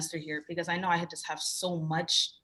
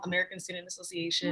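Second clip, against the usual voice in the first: usual speaking voice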